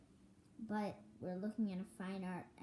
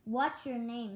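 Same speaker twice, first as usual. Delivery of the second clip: loud question; 4 semitones higher, 10 dB louder, slower than usual. Acted. Shouted at deaf old man.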